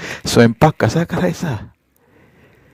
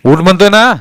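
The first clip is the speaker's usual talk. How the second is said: loud, higher furious snap; snapping again; 8 semitones higher, 8 dB louder than usual. A narrator's reading. Husband snapping at his unmoving wife, furious. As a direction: bark the order again. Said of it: "तो पुन्हा खेकसला".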